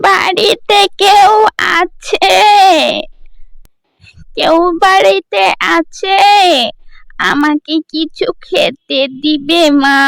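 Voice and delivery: put-on slow, pleading old woman's call at the door; old beggar woman. Old beggar woman calling at the girl's door, slow and pleading. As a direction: beg slowly like a hungry old woman.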